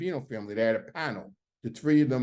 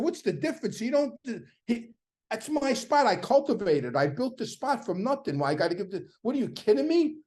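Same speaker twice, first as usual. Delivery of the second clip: much higher, heated disbelief; irate outburst; 8 semitones higher than usual. Retold or imitated. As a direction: demand it, voice cracking upward in outrage. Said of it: "Now I'm irate".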